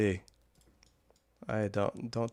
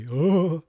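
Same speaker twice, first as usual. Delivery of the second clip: high loud laugh; burst of laughter; 8 semitones higher, 7 dB louder than usual. Real, not acted. Laughs reading mod list.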